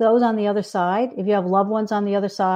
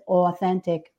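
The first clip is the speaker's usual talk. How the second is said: New York "aw" opening the word; New York accent; 3 semitones lower, slower than usual. Shown as a demonstration. Author quoting own slip, light New York accent. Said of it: "did you hear my New York accent?".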